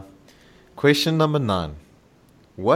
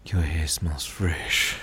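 slow, low, creepy murmur; creepy stranger; 6 semitones lower, much slower than usual. Acted. Host playing a creepy stranger on the street, slow and low. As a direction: leer it out slowly to a passerby. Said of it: "I'd pass the stranger and be like".

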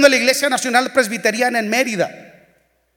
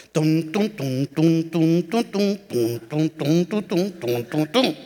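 soft low vocal drum tum tum; drumbeat imitation; 6 semitones lower, 5 dB quieter, slower than usual. Preacher imitates the drumbeat of nineties praise songs, soft and low. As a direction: mimic the praise band's drum softly. Imitating worship music beat.

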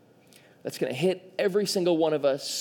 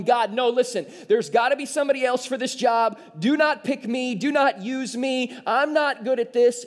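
higher-pitched, insistent pleading as Moses; 5 semitones higher than usual. Acted. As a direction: plead urgently to be let off. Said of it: "Moses pleads with God to reconsider".

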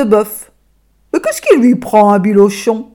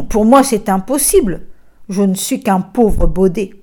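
ordinary delivery, nothing marked

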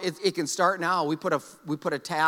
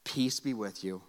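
gentle Jesus; 8 semitones lower, 8 dB quieter than usual. Acted. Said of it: "Jesus says the words".

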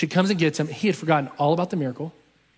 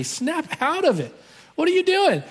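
very high-pitched mock outburst; exasperated scolding; 14 semitones higher, 4 dB louder than usual. Mom about to smack dad, exasperated high scolding. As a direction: imitate a wife at her limit, drawn out then louder.

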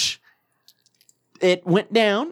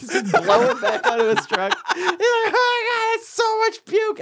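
much higher, laughing through it. Retold or imitated. Imitating Mike cracking up over the puke, laughing high. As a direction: squeal it out between laughs.

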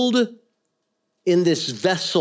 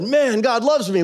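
higher, pleased exclamation; mock elation; 6 semitones higher than usual. Fickle believer when things are good, mock elation. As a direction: pipe up as if life is going great.

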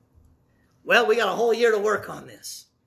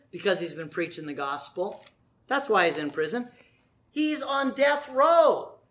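ordinary talk, nothing marked

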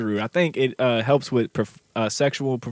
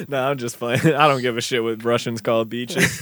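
ordinary delivery

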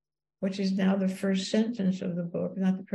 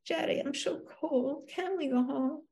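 higher, softer wifely whine; murmured complaint; 7 semitones higher, 5 dB quieter than usual. A narrator's reading. Wife complaining to senator at the parade, murmured plea. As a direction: plead softly, pitched up. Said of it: "began to murmur complaints".

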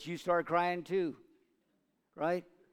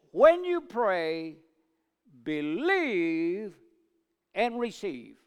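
proclaiming; 2 semitones higher, 6 dB louder, much slower than usual. Acted. Emphatic scripture proclamation.

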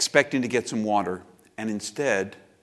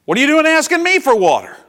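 loud, high-pitched indignant challenge; defensive outburst; 14 semitones higher, 10 dB louder than usual. Acted. Samaritan woman challenging Jesus's request, defensive outburst. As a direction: throw it back at him, loud and offended.

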